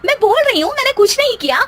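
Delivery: high-pitched indignant denial in a squabble; indignant protest. Acted. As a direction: fire back, you did nothing wrong. Bahu denies blame in quarrel.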